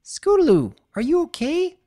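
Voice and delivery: worried call. Acted.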